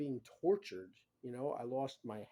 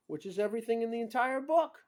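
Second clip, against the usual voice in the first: real outburst; 10 semitones higher, 10 dB louder than usual. Real, not acted. Outraged listing his diagnoses.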